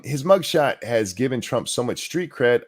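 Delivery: ordinary talk, nothing marked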